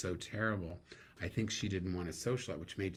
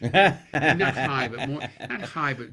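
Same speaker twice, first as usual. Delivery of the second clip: laughing; 6 semitones higher, 17 dB louder, slower than usual. Real, not acted. Laughing while describing actor's voice.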